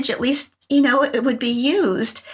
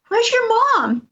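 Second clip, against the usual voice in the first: urgent demand; 11 semitones higher, slower than usual. Retold or imitated. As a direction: ask it fast, frightened for them. Urgent question to small children.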